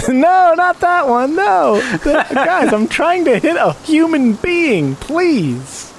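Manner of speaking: very high, drawn-out comic protest; hammed-up high-pitched begging; mock pleading. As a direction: plead in a squeaky-high voice, half laughing. Mock pleading over shooting a horse.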